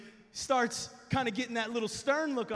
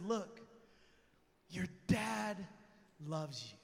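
tender reassurance; 4 semitones lower, 9 dB quieter than usual. Retold or imitated. Dad tenderly comforts son.

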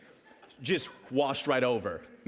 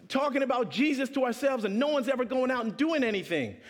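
frustrated rant; 9 semitones higher than usual. Retold or imitated. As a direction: let the frustration pour out. Frustrated outburst retold.

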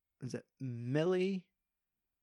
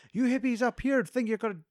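higher, louder angry yelling; drunken rant; 10 semitones higher, 4 dB louder than usual. Retold or imitated. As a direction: run them off the street. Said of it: "telling you, it's like".

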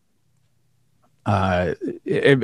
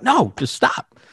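much higher, hammed-up plea to stop; high mock plea; 12 semitones higher, slower than usual. Husband hamming up a refusal to his wife, squeaky. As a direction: squeak out a pretend protest.

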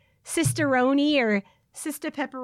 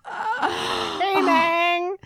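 high-pitched, hammed-up Cuban accent; 6 semitones higher than usual. Repeating the Scarface line after laughing, high mock Cuban accent. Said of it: "in his, like, Cuban accent".

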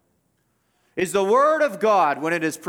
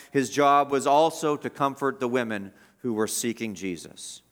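ordinary talk

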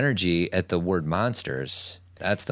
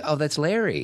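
higher, offhand mock dad; dad's casual punchline; 9 semitones higher than usual. Father casually naming the closet monster, higher and offhand. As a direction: toss it off like it's nothing.

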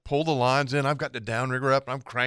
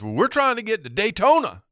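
high retold protest; baffled lost boater; 9 semitones higher, 3 dB louder than usual. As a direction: voice the confused boater in a raised pitch. Boater explains where they are going.

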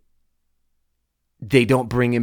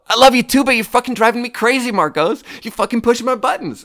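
much higher and louder, fed-up; mock exasperated outburst; 12 semitones higher, 9 dB louder than usual. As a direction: yell it like he's driving you crazy. Telling off a coworker, half-joking.

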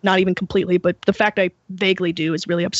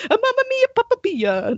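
mock Italian accent, much higher; hammed-up Italian accent, pitched way up; bad Italian accent; 13 semitones higher than usual. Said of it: "that Italian accent".

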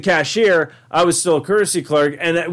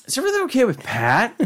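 boss asking gently; 7 semitones higher, faster than usual. Retold or imitated. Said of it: "And then so, like".

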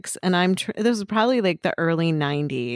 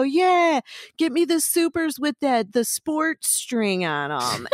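high mock holler from aisles away; niece calling back; 7 semitones higher, slower than usual. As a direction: imitate a niece calling back across the store. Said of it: "three aisles over, you hear my niece go".

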